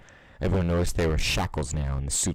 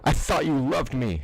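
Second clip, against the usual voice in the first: high, pleading wail; young pony wailing at her hero; 9 semitones higher than usual. Acted. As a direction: cry it out to someone who betrayed you. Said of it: "she wailed".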